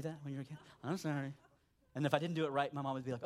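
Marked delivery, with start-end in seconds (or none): 0.8-1.3 lower, grudging sorry; insincere apology. Retold or imitated. Kid forced by mom to apologize to sister, insincere. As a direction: mumble it like you don't mean it. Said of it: "the most insincere apology imaginable".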